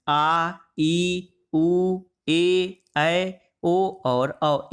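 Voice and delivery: steady, held vowel sounds from आ through औ; long vowels. Shown as a demonstration. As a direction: draw each vowel out long, one at a time. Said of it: "दुगुना समय लगता है".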